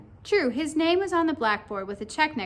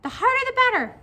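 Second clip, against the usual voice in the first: high, loud squeaky creature; loud high-pitched cartoon voice; little pigtail voice; 13 semitones higher, 7 dB louder, faster than usual. A narrator's reading. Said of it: "said the pigtail".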